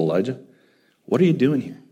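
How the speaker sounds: ordinary delivery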